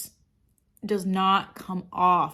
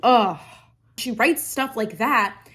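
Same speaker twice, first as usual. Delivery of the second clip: ordinary talk, nothing marked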